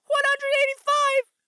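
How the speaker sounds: high, drawn-out cry of disbelief; shocked exclamation. Retold or imitated. Shocked repeat of heart rate.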